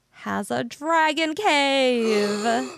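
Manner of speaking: slow dramatic build on dragon cave; dramatic reveal. Acted. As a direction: draw it out for suspense.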